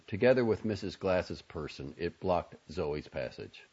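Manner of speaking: usual speaking voice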